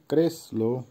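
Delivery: ক্রেসলো split into ক্রেস and লো; teacher's spaced-out repeat of ক্রেসলো; model pronunciation of ক্রেসলো. Shown as a demonstration. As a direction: say ক্রেসলো in two careful parts. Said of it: "আবারও বলছি".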